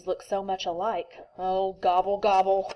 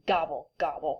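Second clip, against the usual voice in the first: grumbled; 5 semitones lower than usual. Acted. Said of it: "grumbled Turkey".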